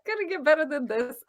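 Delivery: put-on high, loud childlike yay of delight; squealing happy self-quote; gleeful cheer. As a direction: cheer it like a kid who just got great news. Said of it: "for me, that was like".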